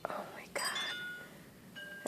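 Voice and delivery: breathless whispered "oh my God". Real, not acted. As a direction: whisper it in awe. Stunned by deep snow.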